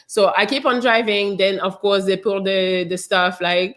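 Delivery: usual speaking voice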